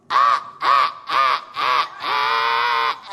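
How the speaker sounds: low, loud croaking cries; dying bird cries. Acted. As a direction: croak out the bird's death. Bird shot by arrow.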